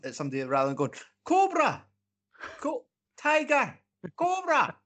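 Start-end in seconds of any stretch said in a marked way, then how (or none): 1.2-4.8 high yelled animal names; shouting. Pretend. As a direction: shout it across a noisy bar. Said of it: "just to shout".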